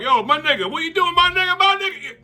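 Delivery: hammed-up street slang; exaggerated street voice; street impression. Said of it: "That's how they talk".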